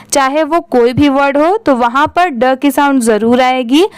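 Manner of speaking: usual speaking voice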